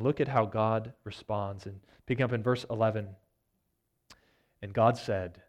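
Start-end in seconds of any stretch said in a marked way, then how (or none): none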